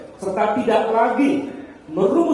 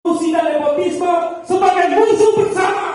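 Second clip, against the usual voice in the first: fiery revolutionary speech; 9 semitones higher, 5 dB louder than usual. Real, not acted. Rally declaration, shouted.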